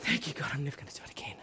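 low, hushed aside to God; relieved prayer. Retold or imitated. Preacher privately thanks God afterwards, quietly relieved. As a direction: drop to a near whisper, as if confiding.

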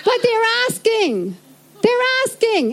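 pleading high, voice lifting; high pleading protest. Retold or imitated. Young bank clerk pleads with her manager, high pleading protest. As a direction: plead your case in a high voice.

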